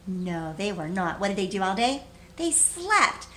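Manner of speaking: usual speaking voice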